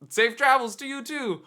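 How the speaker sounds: thrilled fan's loud goodbye; loud eager farewell. Retold or imitated. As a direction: answer the king with giddy gusto. Replying to the king.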